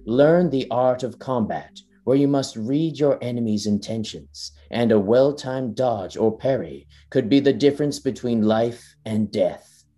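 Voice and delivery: grand, measured trailer voice, slowing into the last words; epic trailer narration. Acted. Podcaster reading the Elden Ring trailer aloud, epic narration. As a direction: narrate it like a fantasy game trailer.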